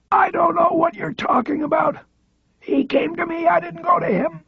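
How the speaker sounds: high, thin voice of a frail paralysed man; frail high voice, rattled; frail old man. A narrator's reading. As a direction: squeeze it out high and weak.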